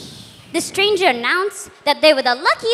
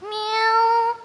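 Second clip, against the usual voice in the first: higher put-on cat meow; 5 semitones higher than usual. Cat answers while doing the housework.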